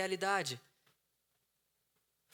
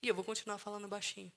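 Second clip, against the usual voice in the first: softer, calm demonstration; speaking softly; 3 semitones higher, 6 dB quieter, faster than usual. Shown as a demonstration. Showing how to answer a yelling partner, softly. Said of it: "tô falando super baixinho".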